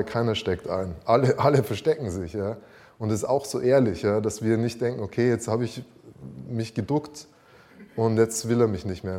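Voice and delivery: loud, encouraging preaching; rally the congregation; stirring sermon delivery. Acted. Louder preaching passage.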